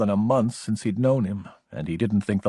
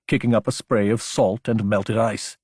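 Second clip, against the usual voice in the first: ordinary delivery, nothing marked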